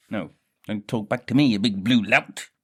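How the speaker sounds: loud fast mock telling off; mock scolding. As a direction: bark at him like a cross parent. Scolding a figure.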